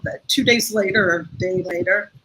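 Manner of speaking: normal talking voice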